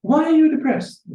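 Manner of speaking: high, blunt and direct; blunt direct question. Retold or imitated. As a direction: ask it straight out, no sympathy padding. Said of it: "His Holiness is very direct".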